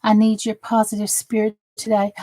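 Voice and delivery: ordinary delivery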